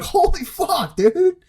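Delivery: high, squeaky shocked exclamation; jaw-drop gasp of disbelief; stunned disbelief. Retold or imitated. Batter seeing Greinke take the mound, shocked and high. Imagining Greinke pitching derby.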